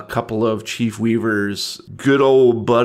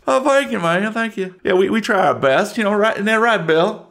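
sneering small-town Southern voice; Southern yokel; 10 semitones higher than usual. Acted. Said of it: "I'm from the South".

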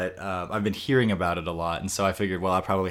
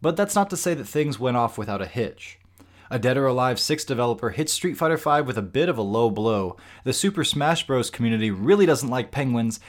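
upbeat show-opener read, a touch higher; headline intro read; 4 semitones higher than usual. Acted. Host opening the podcast for listeners, upbeat headline read. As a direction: sell the week's stories like a news teaser.

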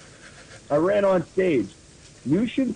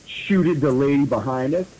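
ordinary delivery, nothing marked

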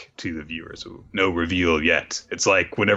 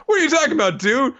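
high grandiose braggart; 4 dB louder than usual. Retold or imitated. Daybreak demanding fame, high and grandiose. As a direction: proclaim it grandly.

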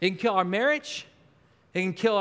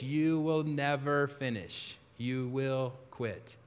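much softer and lower, slow, a heavy discouraging murmur; discouraging inner voice; 9 semitones lower, 8 dB quieter, slower than usual. Acted. Preacher voicing the inner voice that says you'll quit, low slow murmur. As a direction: let it sink in low and slow like the doubt inside. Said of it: "that's my number one voice".

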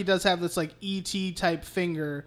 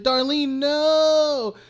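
higher, drawn-out warning with a long "no"; mock alarmed cry; 8 semitones higher, 5 dB louder, much slower than usual. Andy seeing Chucky eye Darlene, mock alarm. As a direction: act out a panicked warning.

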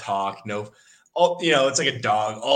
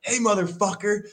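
retold shout; 10 semitones higher than usual. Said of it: "And I was like".

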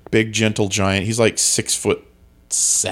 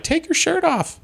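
imitated crowd call. Audience after the show urging him to strip, high mock call. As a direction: call it out high like an eager fan. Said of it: "people are like".

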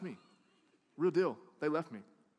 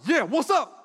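loud, high defiant yell; yelling back; 8 semitones higher, 10 dB louder than usual. Retold or imitated. Telling how they yelled back at mom, cocky yell. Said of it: "and I yell back".

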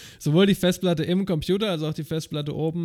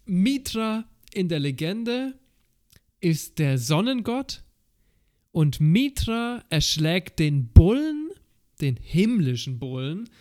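dramatic legend narration; 4 dB louder, slower than usual. Pretend. Slow legend telling.

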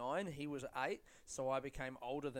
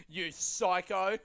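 louder, slow jab; mock yelled insult; 4 semitones higher, 8 dB louder, much slower than usual. Comedian yelling at anyone who mocked him, loud and slow. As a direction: shout it slowly at an imaginary critic. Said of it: "Who am I yelling at?".

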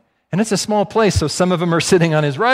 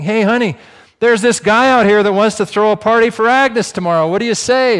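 high, loud call to the back room; 8 semitones higher, 8 dB louder than usual. Retold or imitated. Diner owner calls to wife cooking in back, loud call. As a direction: holler it to someone out of sight. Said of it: "He calls back to his wife".

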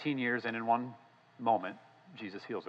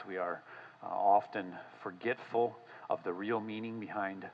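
softer, unhurried prayer voice; 3 semitones lower, 5 dB quieter than usual. Acted. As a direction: pray humbly, in a low-key tone. Leads the closing prayer.

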